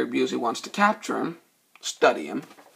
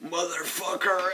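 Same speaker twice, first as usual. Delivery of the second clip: predator growl; 4 semitones higher, 4 dB quieter than usual. Retold or imitated. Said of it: "the Predator wakes up and goes".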